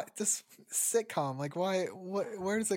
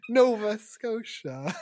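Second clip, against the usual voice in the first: mock New York accent; 3 semitones higher, 7 dB louder, slower than usual. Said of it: "in a New York accent".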